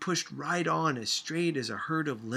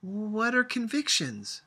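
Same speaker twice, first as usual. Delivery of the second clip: scream; 10 semitones higher, 4 dB louder than usual. A narrator's reading. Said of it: "Sophia screamed and stamped her foot".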